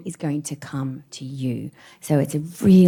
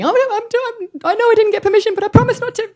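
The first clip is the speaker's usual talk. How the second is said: высоко, сбивчиво, в панике; high, stammering, caught out and flustered; flustered babble; 4 dB louder than usual. Retold or imitated. Flustered reply to old classmate.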